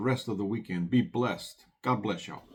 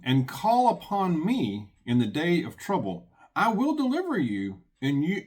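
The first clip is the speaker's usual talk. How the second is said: scripture reading; 4 semitones higher, 4 dB louder than usual. Acted. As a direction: read it out like a preacher at the pulpit. Reads scripture aloud.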